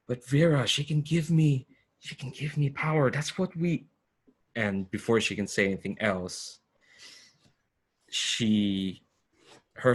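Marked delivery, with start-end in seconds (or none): 0.1-3.8 slightly acted, higher-pitched protest; lightly voiced pleading woman. Game master as Ariadne protesting to Vera, lightly pleading. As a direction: plead a little higher, cut off mid-sentence. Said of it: "and she says".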